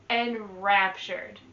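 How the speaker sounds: teacher repeating Enraptured slowly; slow clear model word. Shown as a demonstration. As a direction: drill Enraptured at an easy pace. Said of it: "vocabulary word repeated".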